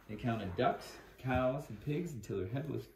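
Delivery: plain reading voice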